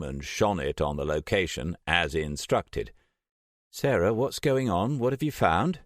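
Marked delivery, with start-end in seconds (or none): 3.7-5.8 higher, worried question; Trish's voice. A narrator's reading. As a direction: Trish pressing for an answer.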